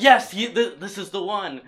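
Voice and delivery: loud, high excited proclamation; excited rabbis. Retold or imitated. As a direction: announce it like a thrilled believer. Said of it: "they could get enough rabbis to be like".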